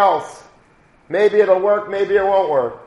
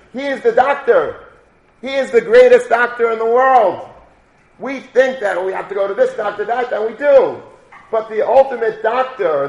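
rising, louder sermon climax; preaching at full force; 3 semitones higher, 4 dB louder than usual. Acted. Preacher tells the congregation that God is the doctor, fervent build. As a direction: drive the lesson home with building fervour.

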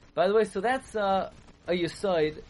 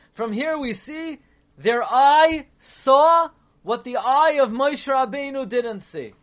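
emphatic; 9 semitones higher, 9 dB louder, slower than usual. Real, not acted. Stressing an astonishing claim.

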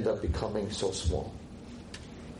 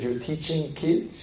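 slow, higher-pitched disbelieving question; incredulous; 6 semitones higher, slower than usual. Retold or imitated. Rabbi hearing his former student now teaches, incredulous. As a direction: draw it out in retold disbelief.

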